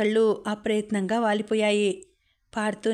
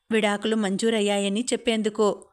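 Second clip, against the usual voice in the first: ordinary delivery, nothing marked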